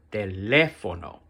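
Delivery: "teléfono" with exaggerated weight on é; extra stress. Shown as a demonstration. Teacher repeats the example for students, stressed second syllable in "teléfono". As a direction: make the "lé" in "teléfono" stand out. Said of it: "just put extra stress".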